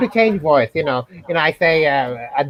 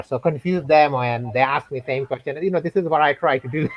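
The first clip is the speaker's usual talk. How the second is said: normal talking voice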